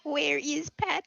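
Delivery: high, slow chipmunk Vader; squeaky cartoon chipmunk voice. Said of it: "using the chipmunk voice".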